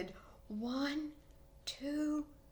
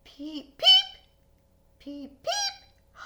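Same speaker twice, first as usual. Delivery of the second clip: tiny squeaky peeps, very high; high frog peeps; 6 dB louder, slower than usual. Acted. Storyteller voicing the frogs, high peeping. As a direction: chirp like little spring peepers.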